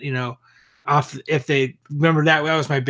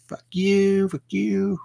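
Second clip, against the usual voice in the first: drawn-out, higher-pitched mock "fuck you"; imitated defiant kiss-off, pitched up; mock defiance; 6 semitones higher, much slower than usual. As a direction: toss off a taunting, stretched-out retort. Acts out the group's defiant exit.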